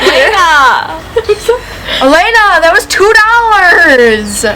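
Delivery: loud laughing, drawn out; laughing outcry. Real, not acted. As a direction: call out while laughing. Friend broke cheap eyeliner.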